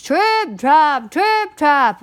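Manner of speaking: loud, punchy stamping words, high "trip"; very loud hoofbeats. A narrator's reading. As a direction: stomp each word out loudly. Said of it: "went his hooves very loudly".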